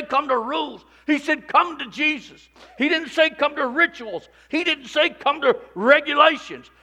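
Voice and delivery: loud, high, driving preacher's call; full-force preaching. Acted. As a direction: preach it hard to the whole room.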